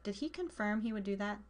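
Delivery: ordinary delivery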